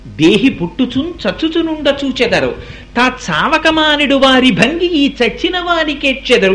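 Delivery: slow verse chant, climbing in pitch as it builds; poem recitation. Acted. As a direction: intone the poem with long, held syllables. (పద్యం రాగంతో, నెమ్మదిగా, స్వరం పెంచుతూ)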